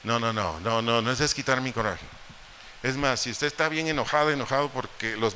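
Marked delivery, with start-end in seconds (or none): none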